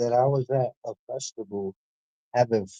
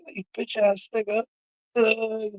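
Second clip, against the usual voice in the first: pitched higher, whiny mock of a jealous rapper; mocking hater voice; 9 semitones higher than usual. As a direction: sneer it like a petty hater.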